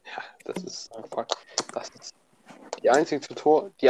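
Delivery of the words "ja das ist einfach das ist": unvoiced whisper, trailing off. Real, not acted. Whispering to a friend about the tutors, hushed. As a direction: breathe it out as a hushed aside.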